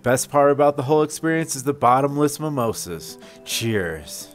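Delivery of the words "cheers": ordinary delivery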